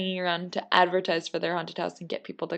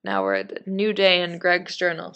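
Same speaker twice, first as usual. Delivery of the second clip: usual speaking voice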